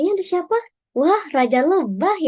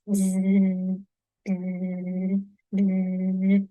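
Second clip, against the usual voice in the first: low buzzing like a bee; bee buzz imitation; 5 semitones lower, much slower than usual. Shown as a demonstration. Imitating a bee buzzing for the guessing game. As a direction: buzz it for the kids. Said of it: "Coba sekali lagi ya".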